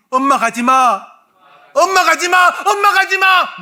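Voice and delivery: second take at full force, calling mother back. Shown as a demonstration. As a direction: scream it at the top of your voice. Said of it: "더 크게".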